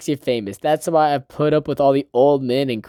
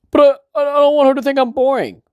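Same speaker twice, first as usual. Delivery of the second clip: much higher, louder anxious whine; whiny Kazuya; 11 semitones higher, 4 dB louder than usual. Retold or imitated. Kazuya anxiously whining to Minnie about seeming boring. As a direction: whine it like a worried boy. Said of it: "Kazuya's like".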